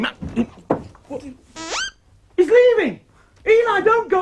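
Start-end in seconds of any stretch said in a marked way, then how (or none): 2.4-4.2 sudden alarmed cry. Real, not acted. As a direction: call out in alarm. Alarmed at dummy leaving.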